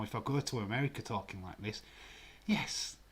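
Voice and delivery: usual speaking voice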